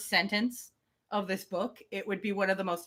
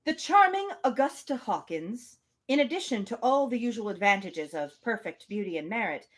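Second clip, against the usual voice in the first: measured reading of a classic novel; story narration. Acted. Podcast host reading Emma aloud, measured narration. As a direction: read it like an audiobook narrator.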